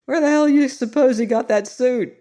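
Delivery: high, loud exclamation; exclaiming. A narrator's reading. TV man mocks the stranger's suit, exclaiming high and loud. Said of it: "he exclaimed".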